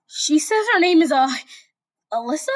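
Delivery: high, hesitant voice; timid Deku. Acted. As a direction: ask it unsure. Deku unsure.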